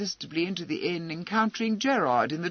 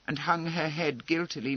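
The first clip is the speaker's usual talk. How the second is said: ordinary delivery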